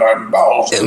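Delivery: lightly voiced monster, a put-on timbre; Bowser. Co-host shows the voice the actor should do, light monster voice. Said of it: "doing a voice like".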